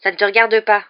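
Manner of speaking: brusque and annoyed, loud; curt retort. A narrator's reading. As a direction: shut the question down sharply. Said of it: "répliqua sèchement Ron".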